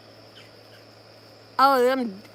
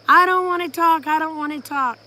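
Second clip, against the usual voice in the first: loud, high mock tantrum easing slightly; imitated screaming; 5 semitones higher, 8 dB louder than usual. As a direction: mimic a niece yelling that she refuses. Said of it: "She's screaming".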